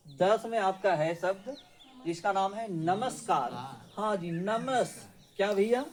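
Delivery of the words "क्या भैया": normal talking voice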